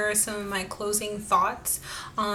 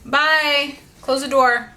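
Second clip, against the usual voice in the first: yelling across the room; 4 semitones higher, 7 dB louder, slower than usual. Real, not acted. Calling out to someone leaving.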